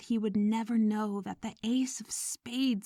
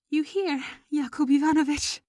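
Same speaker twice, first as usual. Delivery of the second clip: distressed wife calling; 7 semitones higher, 6 dB louder than usual. Acted.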